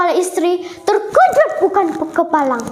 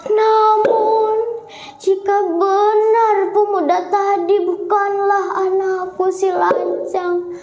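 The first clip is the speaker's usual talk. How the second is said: high, sung lament of a wronged mother; old mother praying in sorrow, much higher; mother's prayer; 6 semitones higher than usual. A narrator's reading. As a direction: cry out to God in grief. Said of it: "kata emak".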